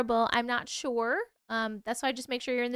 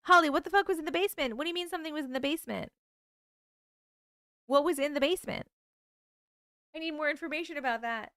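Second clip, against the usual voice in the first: excited; 4 semitones higher, much faster than usual. Real, not acted. Demanding what was in basement.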